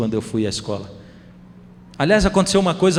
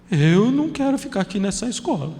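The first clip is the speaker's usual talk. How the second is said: put-on child voice; 6 semitones higher than usual. Son refuses at school gate.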